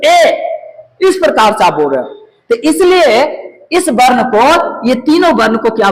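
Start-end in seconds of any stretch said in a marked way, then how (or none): 0.0-1.1 raised, isolated "ए" with the mouth pulled askew; higher-pitched teaching "ए", mouth skewed; skewed-mouth vowel. Shown as a demonstration. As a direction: demonstrate "ए" by stretching the mouth crooked. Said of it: "एकदम टेढ़ा करके मुंह बोल रहे हैं".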